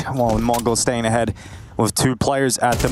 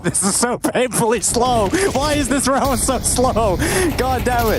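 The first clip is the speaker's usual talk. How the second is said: exasperated complaint; 13 semitones higher than usual. Real, not acted. Annoyed at slow round.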